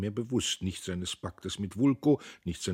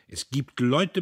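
heated claim; 6 semitones higher, 6 dB louder, slower than usual. A narrator's reading. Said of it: "behauptete Heuteufel".

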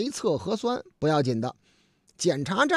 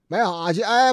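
ordinary talk, nothing marked

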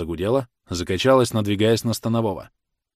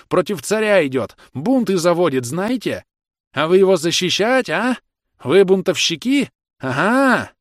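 high, agitated, raised-voice ranting; hoarse alarmed shouting; 9 semitones higher, 4 dB louder than usual. A narrator's reading. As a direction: shout in alarm while backing away. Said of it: "он кричал хрипло и тревожно". (хриплый тревожный крик)

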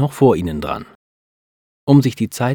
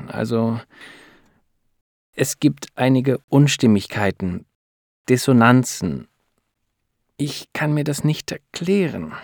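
halting, with searching pauses; hesitant; 5 semitones higher than usual. A narrator's reading. Doctor struggles to explain discrepancies to the couple, hesitant. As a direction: grope reluctantly for the words, slightly raised pitch.

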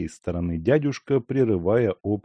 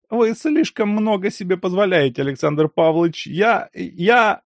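indignant outburst; 11 semitones higher, 5 dB louder than usual. A narrator's reading. Lyakhovsky indignant.

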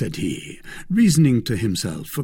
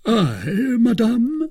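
mock proud; 14 semitones higher, 5 dB louder, slower than usual. A narrator's reading. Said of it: "drawing himself up proudly".

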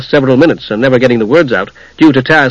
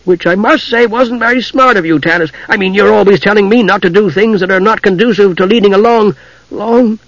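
higher-pitched kender scolding; stern; 7 semitones higher than usual. A narrator's reading. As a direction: lecture him sternly in a high voice. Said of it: "He grew stern".

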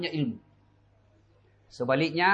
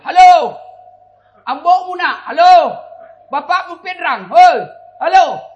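high, very loud phone bellow; comic full-voiced shouting; yelling; 12 semitones higher, 14 dB louder than usual. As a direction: holler down the phone as if it won't connect.

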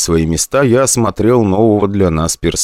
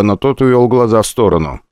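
usual speaking voice

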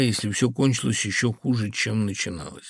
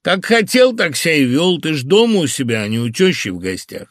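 loud, high start, emphatic retort; stern; 5 semitones higher, 7 dB louder than usual. A narrator's reading. Phil telling the host he was at home, stern. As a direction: lay it down firmly and sternly. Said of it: "сурово сказал Фил".

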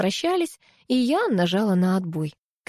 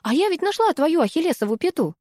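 thrilled, much higher announcement; excited triumphant news; delighted; 10 semitones higher than usual. A narrator's reading. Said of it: "восторженно сообщила я".

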